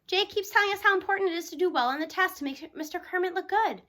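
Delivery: ordinary delivery, nothing marked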